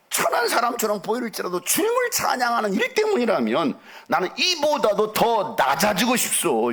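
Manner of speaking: high, ringing kingly vow; high-pitched, slowing exalted declaration; fervent David. Acted. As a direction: declaim it passionately, drawing out the final words. Voices David's defiant vow to God.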